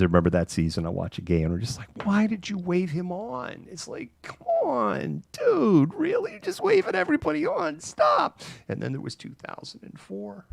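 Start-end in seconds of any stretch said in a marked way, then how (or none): 2.0-8.4 high, incredulous complaint at the TV; very high whiny exasperation; exasperated fan. Retold or imitated. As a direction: plead in disbelief, pitch climbing. Fan yelling at TV coach.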